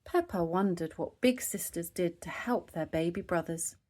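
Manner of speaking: ordinary delivery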